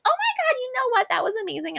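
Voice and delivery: high, excited mock gushing; gushing praise. Grateful friends gushing to her, high and excited. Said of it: "later they'd be like".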